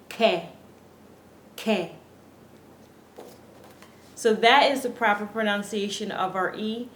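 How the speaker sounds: normal talking voice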